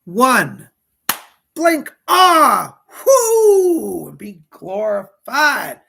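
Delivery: high, loud cry of release; ecstatic shout. Acted. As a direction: burst out in a cry of joy. Climax of the blink meditation.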